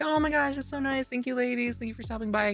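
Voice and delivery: high, bubbly customer service voice; chirpy clerk. Retold or imitated. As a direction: play a sugary shop clerk. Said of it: "and they're like".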